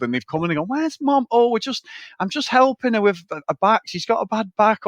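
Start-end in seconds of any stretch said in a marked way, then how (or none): 0.7-1.3 much higher, lightly voiced child asking; an innocent kid asking for Mum; mock kid voice. Kids asking in retold scene. 1.3-4.9 higher, a hint of a flustered father; mock flustered dad. Dad covering up to kids.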